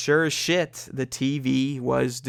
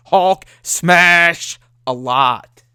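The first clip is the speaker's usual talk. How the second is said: slow, loud, hammed-up brute monster voice; Hulk; 3 semitones higher, 8 dB louder, much slower than usual. Gamer joking about his rage, in a slow booming Hulk voice. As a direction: drag it out like a dumb green giant. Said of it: "very Hulk-like".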